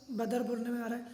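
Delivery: ordinary talk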